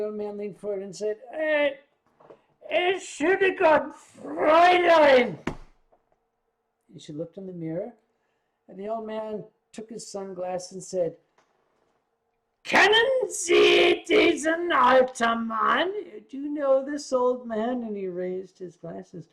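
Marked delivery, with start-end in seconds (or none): 1.3-5.4 high, slow put-on old man; creaky disguise of an old man, drawn out; playful old man voice, louder and slower. Old man in disguise addressing driver. 12.6-17.8 high, slow put-on old man; creaky disguise of an old man, drawn out; playful old man voice, louder and slower. Old man reveals himself to Tani.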